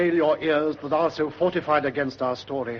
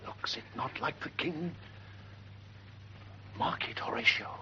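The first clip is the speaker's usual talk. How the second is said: softer, almost whispered; hushed awe; 11 dB quieter than usual. Acted. Guard nudging Horatio in a hushed voice.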